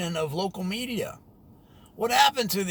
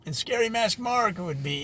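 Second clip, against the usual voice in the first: ordinary talk, nothing marked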